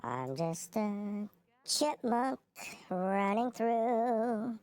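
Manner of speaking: high, slow chipmunk voice; squeaky chipmunk voice, drawn out. Pretend. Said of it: "with a chipmunk voice".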